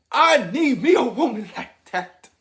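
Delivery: mock the man who hears her, loud and keen; man wanting that. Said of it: "a man gonna be like".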